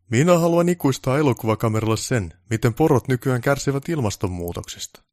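self-important director voice; pompous director. Acted. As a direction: demand it like a big boss. Director explains his vision.

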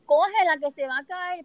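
imitated urgent yell, high and slow; retold shout. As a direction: call out loudly as the man yelling for a medic. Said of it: "él le grita al médico".

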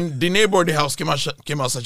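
ordinary talk

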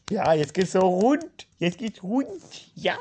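high, loud, drawn-out cheer; hyped whoop. Acted. Sudden outburst that things get wild, hyped whoop.

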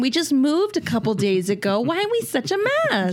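high, wailing mock lament; mock dismay. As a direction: play exaggerated bewilderment. Acting out couple's dismay over dinner.

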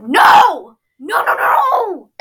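loud, high hammed-up no no no; mock shout. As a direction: yell it like a panicking Zuko. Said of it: "Zuko's like".

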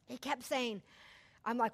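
usual speaking voice

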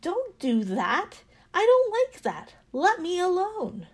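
high, loud, indignant yelling; yelled protest. A narrator's reading. Teacup to the master pounding it, yelled protest. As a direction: yell at the one hurting you to stop. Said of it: "and I yelled".

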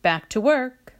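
moderately loud shouted send-off. A narrator's reading. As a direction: call out that it's time to work. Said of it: "he shouted".

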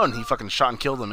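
ordinary talk

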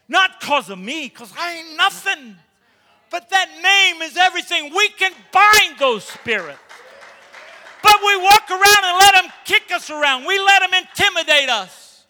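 loud, high fervent preaching. Real, not acted. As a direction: shout it out to the congregation. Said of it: "preaching at full force".

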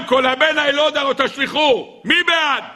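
high-pitched, sustained proclamation; proclaiming proposal. Acted. Balaam puts the decree to a cabinet vote, proclaiming. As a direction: call it out to the whole chamber.

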